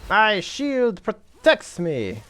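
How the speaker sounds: slow, lofty warrior declaration; hammy hero voice. Pretend. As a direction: boom it out like a proud knight. Character voice line in game.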